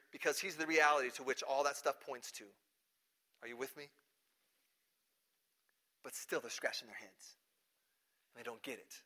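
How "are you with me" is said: ordinary talk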